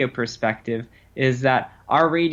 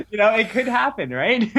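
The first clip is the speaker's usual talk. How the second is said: chuckling through the words, high; laughing; 9 semitones higher than usual. Real, not acted. Guest laughing with the host about luck. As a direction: laugh it off lightly.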